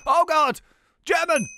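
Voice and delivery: loud, high-pitched alarm; playful alarmed yell; startled cry. Acted. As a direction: shout out in sudden shock. Startled at finding the captive.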